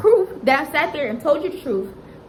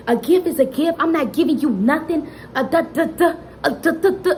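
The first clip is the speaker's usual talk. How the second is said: mocking ex's taunt; 5 semitones higher than usual. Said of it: "talking to me like a dog in the street".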